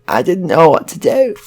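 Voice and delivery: put-on upset hostage in a northern English accent. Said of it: "inexplicably northern".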